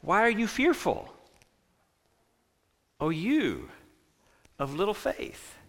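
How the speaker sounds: hushed, voiced near-whisper with long pauses; quiet, tender chiding, fading at the end; gentle whispering. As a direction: murmur it softly into someone's ear. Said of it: "I imagine Jesus whispering in our ears".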